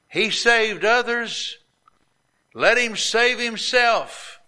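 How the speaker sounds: high, slow jeering taunt; drawn-out mocking taunt, pitched high; mocking rulers. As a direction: sneer it up high like a scoffing ruler. Rulers deriding Jesus on cross.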